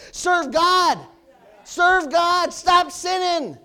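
loud, high command shout, last words stretched slow; Jesus yelling. Acted. Jesus ordering the temple crowd, shouted command. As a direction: bellow it like Jesus clearing the temple. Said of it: "He yelled".

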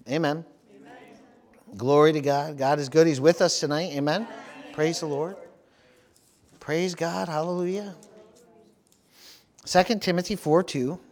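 ordinary delivery

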